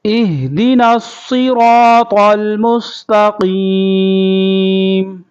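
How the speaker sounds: melodic teacher's tilawat; Quran recitation. Shown as a demonstration. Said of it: "ایک مرتبہ پھر پڑھیں گے۔".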